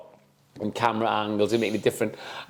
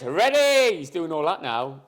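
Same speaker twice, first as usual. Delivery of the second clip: ordinary delivery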